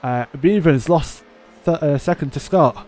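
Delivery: loud, high excited interruption; urgent interjection. Real, not acted. Commentator interrupting co-hosts' banter with race action, urgent and loud. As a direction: burst in over the chatter.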